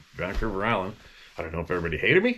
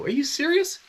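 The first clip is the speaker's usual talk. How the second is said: high, stunned are you serious; shocked disbelief. Retold or imitated. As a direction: jump up in pitch in disbelief. Reacting to cops getting shot.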